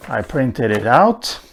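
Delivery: usual speaking voice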